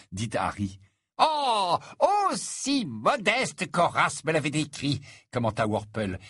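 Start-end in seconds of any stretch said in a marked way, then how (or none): 1.2-5.0 high, effusive flatterer; gushing admirer. Acted. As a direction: coo in exaggerated admiration, pitch lifted. Narrator voices gushing Worple.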